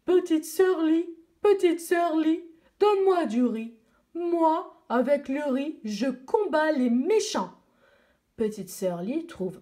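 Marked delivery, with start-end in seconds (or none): 0.0-7.5 higher, louder bold panda voice; proud panda calling out. Acted. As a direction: boast like a brave panda asking for rice. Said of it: "un panda se présente devant elle".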